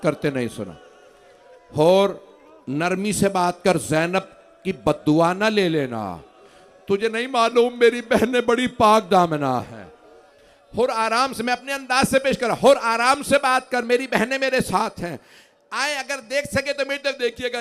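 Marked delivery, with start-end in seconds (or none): 1.8-9.8 impassioned preaching. Acted. 10.8-17.6 impassioned preaching. Acted. Loud impassioned preaching continues.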